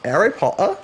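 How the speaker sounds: dropped-H British "Harry Potter", rising questioningly; British accent. Pretend. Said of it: "Try saying, uh, Harry Potter".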